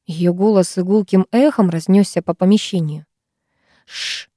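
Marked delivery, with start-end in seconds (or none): 3.9-4.3 soft voiceless "shh"; sudden hush to silence everyone; quiet hissed shush; sharp shushing hiss. A narrator's reading. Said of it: "внезапно зашипела Амелия".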